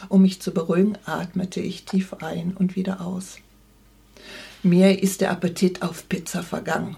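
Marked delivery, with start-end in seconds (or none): none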